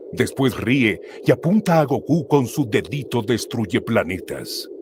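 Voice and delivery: ordinary delivery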